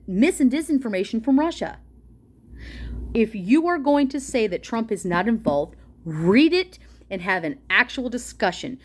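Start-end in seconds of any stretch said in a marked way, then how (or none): none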